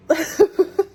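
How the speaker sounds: loud high burst of laughter. Real, not acted. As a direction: crack up out loud. Laughs at vape noise.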